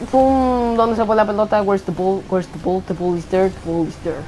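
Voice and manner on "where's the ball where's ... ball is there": low, soft repeated English phrases; playful English muttering. Pretend. Gamer hunting for the ball, low soft English muttering. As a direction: murmur it low, as if to yourself.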